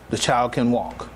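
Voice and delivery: ordinary delivery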